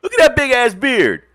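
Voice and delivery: loud, high mock outrage; high-pitched, exasperated hollering; exasperated retold protest. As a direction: yell it in hammed-up indignation. Retells arguing with the waitress.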